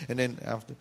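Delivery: ordinary talk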